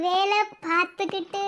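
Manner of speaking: normal talking voice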